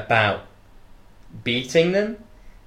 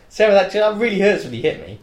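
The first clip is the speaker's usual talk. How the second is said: retold complaint; 8 semitones higher, 3 dB louder, faster than usual. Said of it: "I was like".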